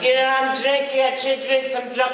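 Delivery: put-on high, loud gushing drunk voice; happy drunk. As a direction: play the sloppy happy drunk who loves everyone. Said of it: "I'm one of them happy drunks".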